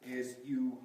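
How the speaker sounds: ordinary talk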